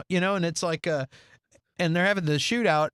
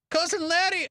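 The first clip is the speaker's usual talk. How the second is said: mock Balky voice; 11 semitones higher than usual. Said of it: "Balky's like".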